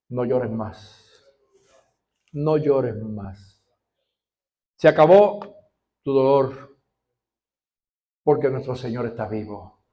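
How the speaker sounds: tender comfort. Acted. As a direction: comfort someone who is still weeping. Jesus comforting the listener.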